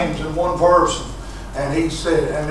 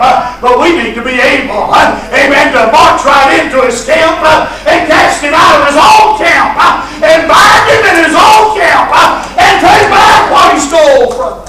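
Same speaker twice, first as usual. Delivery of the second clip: from firm talk up to high, loud shouting; preaching building to a shout; 8 semitones higher, 10 dB louder than usual. Acted. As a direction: climb to a roaring finish.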